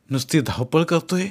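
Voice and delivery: ordinary delivery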